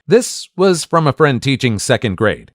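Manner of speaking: plain reading voice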